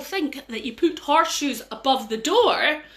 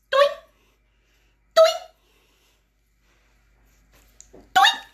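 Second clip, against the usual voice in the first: plucking sound; 6 dB louder than usual. Acted.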